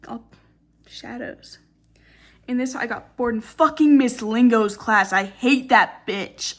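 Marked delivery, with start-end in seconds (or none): 3.4-6.5 loud, heated venting; real strong dislike. Real, not acted. Student venting about a hated English teacher, heated and loud. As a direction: vent about her, louder and emphatic. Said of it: "I have really strong feelings about her".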